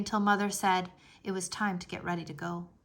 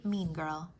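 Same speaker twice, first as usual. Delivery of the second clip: ordinary delivery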